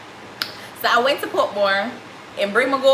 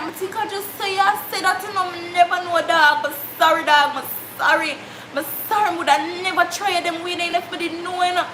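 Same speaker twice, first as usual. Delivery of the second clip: put-on apologetic boy; 5 semitones higher than usual. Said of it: "He's like".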